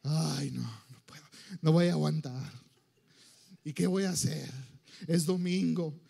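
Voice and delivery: low, weary groan; hammed-up heavy, dragging moan; bored complaining. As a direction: whine like a reluctant churchgoer. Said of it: "qué aburrido va a estar".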